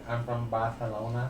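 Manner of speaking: put-on Spanish accent. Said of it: "if you're from Spain".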